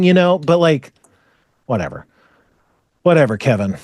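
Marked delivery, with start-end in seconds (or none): 1.7-3.8 low-pitched, resigned brush-off; dismissive. Real, not acted. Speaker gives up on complaint about Kevin, dismissive. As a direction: drop it low and let it go.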